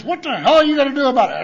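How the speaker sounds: fast angry shout of a tough sponsor; angry challenge. Retold or imitated. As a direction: fire the challenge at him.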